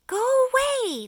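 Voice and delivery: high, loud shout to drive it off; scared creatures shouting down at a shark. Acted. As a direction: yell it away. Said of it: "they shouted".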